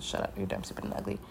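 hushed, breathless retold put-down; whispered insult. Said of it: "so I said".

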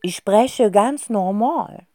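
slow, protesting fox scarf. Acted. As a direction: protest your normality. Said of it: "den Baumelfuchs protestieren". (langsamer, protestierender Fuchs)

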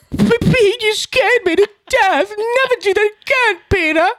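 very high, hammed-up tearful wail; overdone weepy plea in a tiny high voice; mock bawling. Son parodying himself crying over dad's bike ride, mock bawling. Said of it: "bawling my eyes out like".

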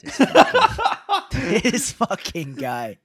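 high, slow words broken by real laughter; laughing disbelief. Real, not acted.

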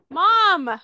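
high, loud, drawn call for mom. Real, not acted. Daughter mid-recording trying to reach her mom, calling out. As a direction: holler to get mom's attention. Calls to mother again.

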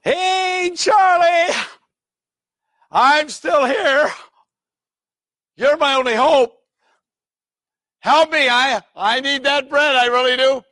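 high, loud call, dragged out; loud pleading call. Acted. Caller pleading with Charlie from the street, loudly. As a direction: plead up at the window, loud and desperate.

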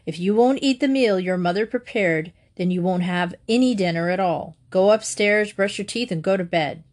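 usual speaking voice

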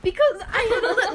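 high, loud laughing protest. Real, not acted. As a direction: laugh out the words.

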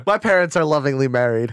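loud, high teasing boast; cheeky brag; smug joke. Real, not acted. Joking boast.

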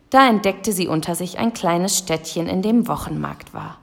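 ordinary delivery

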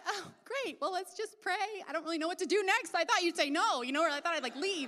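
high-pitched comic awkwardness; retold flustered reply. Acted flustered reply, unsure what next.

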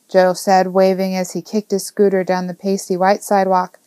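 ordinary delivery

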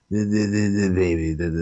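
low, garbled mock mumble; unintelligible mumbling imitation. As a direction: mumble it low so nobody understands. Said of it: "you couldn't understand him".

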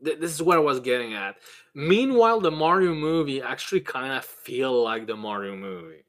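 ordinary talk

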